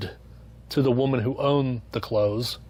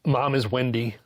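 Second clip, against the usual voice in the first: ordinary talk, nothing marked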